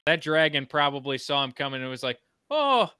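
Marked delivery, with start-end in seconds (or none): 2.5-2.9 put-on sing-song Welsh accent. Welsh dragon greeting Fleur with a story, lazy sing-song. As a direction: put on a lackadaisical Welsh lilt, pitched up. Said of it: "very lackadaisical, very sing-songy".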